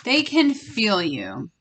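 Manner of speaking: slow and deliberate, leaning on "They"; slow, pointed repeat. Real, not acted. Reader repeating her warning to the feminines, slow and pointed. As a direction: repeat it slowly like a reminder. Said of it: "look, what did I just say?".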